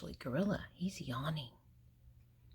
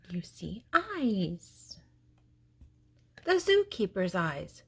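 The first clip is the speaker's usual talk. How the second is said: spooky slow reveal; 4 dB louder, slower than usual. A narrator's reading. Spooky eyes in the dark.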